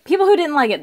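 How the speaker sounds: ordinary talk, nothing marked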